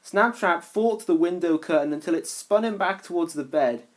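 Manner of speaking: ordinary delivery, nothing marked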